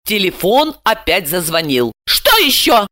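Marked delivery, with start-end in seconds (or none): 2.0-2.9 high-pitched, curt demand; irritated bark. A narrator's reading. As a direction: bark it into the phone. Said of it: "гаркнула Ася". (гаркает раздражённо)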